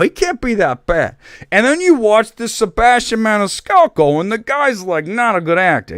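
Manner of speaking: hammed-up Italian accent, pitched high; bad Italian accent. Host reviewing a film in bad Italian accent. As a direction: rant in a terrible high Italian-American voice. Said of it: "it's the shittiest Italian accent".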